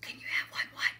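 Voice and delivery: whispered questioning reply; whispering. A narrator's reading. Whispered exchange, Carla replies.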